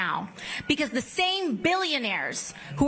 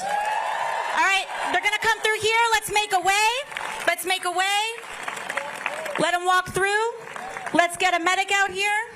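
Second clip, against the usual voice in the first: raised voice, higher and urgent; man down shout; 6 semitones higher than usual. Real, not acted. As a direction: call out to the crowd to clear a path. Clearing a path for medics.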